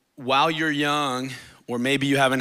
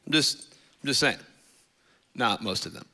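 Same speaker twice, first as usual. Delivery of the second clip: usual speaking voice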